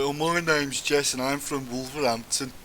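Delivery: slow, mocking broad Wolverhampton accent. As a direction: take off a Wolverhampton lass in her broad accent. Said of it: "broad accent".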